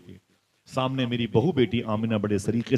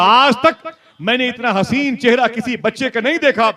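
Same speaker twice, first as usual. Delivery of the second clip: high, loud fervent declaration; fervent preaching; 12 semitones higher, 9 dB louder than usual. Acted. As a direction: declaim it at full force. Preaching at full force. (पूरे जोश में ऊँची, तेज़ आवाज़ में)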